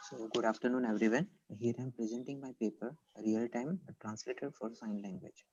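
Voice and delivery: faint, much too soft voice; fading out, hard to hear; barely audible. Real, not acted. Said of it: "Sir, again, it's not audible".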